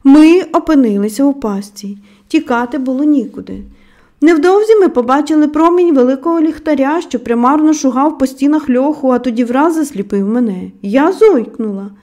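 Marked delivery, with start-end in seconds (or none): none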